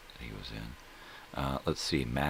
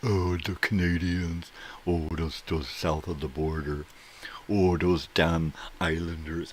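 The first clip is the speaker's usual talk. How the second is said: louder, higher sneering bigot voice; mock bigot; 4 semitones higher, 7 dB louder than usual. Host mocks Americans who dislike foreigners, sneering. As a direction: ham up a prejudiced sneer. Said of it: "give me a break".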